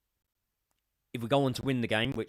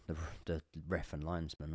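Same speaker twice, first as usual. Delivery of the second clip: whisper; 6 semitones lower, 11 dB quieter than usual. Real, not acted. Muttered aside about the officials.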